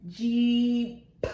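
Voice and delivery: JEEP stretched, then a detached P; sound-by-sound Jeep with a popped ending; ending sound shown. Shown as a demonstration. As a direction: stretch Jeep so the final P stands alone. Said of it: "the last sound in".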